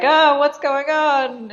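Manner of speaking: mock startled bride. Bride acting surprised under skirt.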